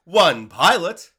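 loud announcement. Acted. Show title call.

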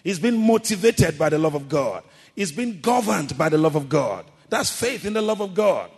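loud, rising sermon delivery, punching each phrase; full-force preaching. Acted. Preacher rousing the congregation about faith, loud and fervent. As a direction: preach it to the back row.

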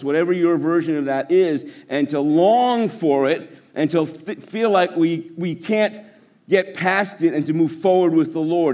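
ordinary talk, nothing marked

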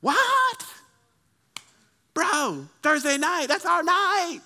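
high-pitched disbelieving whine; incredulous friend. Acted. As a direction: squeal in mock shock at being let down. Friend acted as shocked by refusal.

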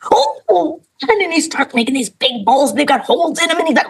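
ordinary talk

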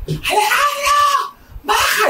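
very high, drawn-out mock shriek; imitated scream. Mother yells for father to come down, imitated scream. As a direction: hysterical mother calling someone downstairs. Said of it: "empezó a gritar".

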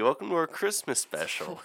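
ordinary talk

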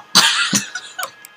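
big burst of laughter; loud laugh. Real, not acted. Laughs at memory.

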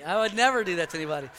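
fast, offhand repeat; rushed, lighter repeat of the denial; quick throwaway. Real, not acted. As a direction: toss the line off quickly.

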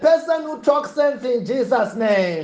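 fiery preaching. Acted. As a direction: proclaim it to the congregation.

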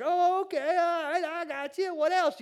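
very high-pitched mock reply; overwhelmed Moses. Preacher voices Moses answering the talking bush, high and rattled. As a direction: play a rattled man squeaking his agreement.

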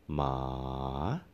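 ma dips low, then rises a little at the end; Thai rising tone. Shown as a demonstration. Teacher repeats the rising tone for students, ma. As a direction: drop ma into the valley and lift it. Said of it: "ma dips down".

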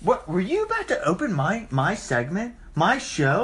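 incredulous, pitch leaping high; mock outrage. Host scolds co-host for opening his segment, mock indignant. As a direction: play the affronted host, hammed-up.

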